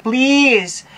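loud, high begging please. Acted. Birthday vlogger begging viewers to comment, loud high plea.

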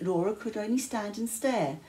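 ordinary delivery

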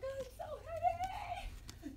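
high, slow, laughing whine; mock whiny complaint. Mocking her daughter's complaint, high laughing whine. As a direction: ham up the drama of a heavy load. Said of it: "she's like".

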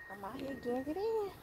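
muffled through a face mask; talking behind a mask. Real, not acted. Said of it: "can't understand me with the mask on".

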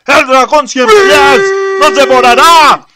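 high, loud screaming in terror; terrified scream. Acted. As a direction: shriek that the monster will eat them. Centipede dragon attacks.

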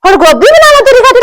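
high, whining protest; whiny retort. Acted. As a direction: complain in a high whine. (ਉੱਚੀ ਪਤਲੀ ਰੋਣਹਾਕੀ ਸ਼ਿਕਾਇਤ)

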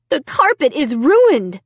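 loud dismayed exclamation; exclaiming. A narrator's reading. Mom exclaiming at the ruined carpet, loud dismay. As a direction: blurt it out in dismay. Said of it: "Mom exclaimed".

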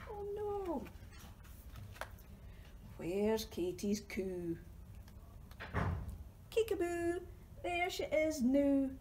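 ordinary talk, nothing marked

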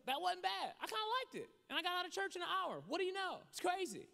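high, loud amazed churchgoer; pleasantly surprised. Retold or imitated. Voicing a churchgoer's happy surprise.

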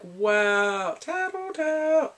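high, drawn-out teasing; slow, high-pitched mock jeer; mock taunt. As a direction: stretch it out like a playground taunt. Teasing reaction to the tattling.

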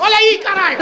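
high, very loud burst; exasperated shout. Acted. Uncovering her eyes at last, exasperated shout. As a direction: yell the reveal in frustration. Frustrated reveal of the proposal.

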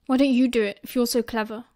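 ordinary delivery

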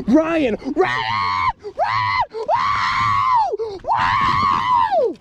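high-pitched panicked calls to Ryan, building to shouts; real panic while fighting a huge fish; frantic excited yelling. Real, not acted. Big fish on, panicking at cameraman.

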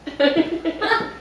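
brief high giggle; quick giggle; real laughter. Real, not acted. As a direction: crack up. Breaks up mid reading.

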